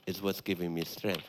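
calm confession. Acted. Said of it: "You say".

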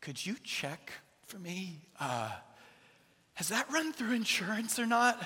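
hesitant, softened request with long pauses; anxious. Retold or imitated. Dad asking the clerk to check insurance, anxiously tentative. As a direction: ask gingerly, rising high at the end. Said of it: "man, my anxiety really rose".

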